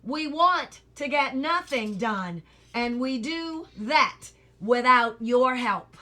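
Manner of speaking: slow, loud and pointed anger; angry. A narrator's reading. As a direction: hammer each word home angrily. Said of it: "another angrily".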